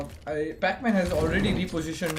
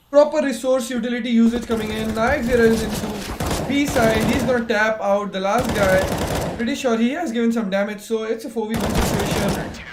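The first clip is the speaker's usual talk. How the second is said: loud, higher hype call of the round; esports caster; 4 semitones higher, 7 dB louder than usual. Real, not acted. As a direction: ride the action to the final kill.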